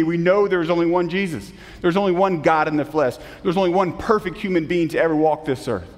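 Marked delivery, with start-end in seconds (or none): none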